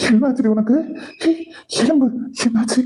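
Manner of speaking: high, frantic cries as she collapses; a husband panicking over his fainting wife; panicked alarm. Acted. Husband panics as his wife collapses, frantic high cries.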